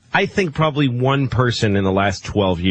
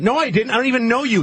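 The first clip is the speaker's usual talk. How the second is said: mock indignant retort; 8 semitones higher than usual. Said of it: "Like she's like".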